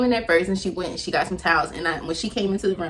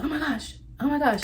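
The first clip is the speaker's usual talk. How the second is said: gasping panic; 8 semitones higher than usual. Retold or imitated. Gasped panic as water broke.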